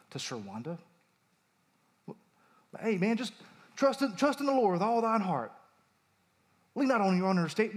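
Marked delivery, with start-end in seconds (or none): 2.8-7.8 higher-pitched put-on Southern accent. Preacher voicing stock comfort to a new widow, Southern accent. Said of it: "I have to use a Southern accent".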